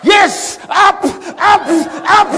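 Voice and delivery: high, loud "up, up"; urgent shouting. Retold or imitated. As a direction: push the plane skyward with the voice. Pilot urging plane up.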